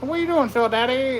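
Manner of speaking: put-on high teasing kid voice; sing-song schoolyard tease; mock taunt. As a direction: imitate the kids ribbing him. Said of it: "people always called me Phil Daddy".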